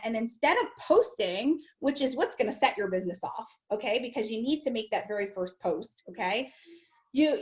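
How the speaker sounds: normal talking voice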